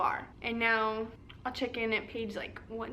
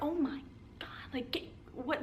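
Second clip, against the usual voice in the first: startled whisper; 3 semitones higher, 7 dB quieter than usual. Retold or imitated. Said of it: "she's like".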